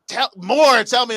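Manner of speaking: eager prodding. Retold or imitated. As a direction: coax a dull guest to open up. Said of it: "it's like".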